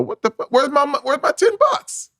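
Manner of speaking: very high, squeaky indignant demand; imitated outraged guy. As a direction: play a spoiled man who lost his handout. Imagined person losing daily money.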